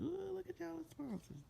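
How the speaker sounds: impressed squeal, hammed up; playful mock awe. Viewer teasing the show about its sponsors, mock awe.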